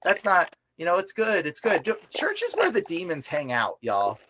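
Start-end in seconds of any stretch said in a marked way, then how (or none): 0.1-1.8 high mock-naive voice; mocking churchgoers. Mimicking naive churchgoers, high mock voice. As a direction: mimic people's easy view of church. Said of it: "people think".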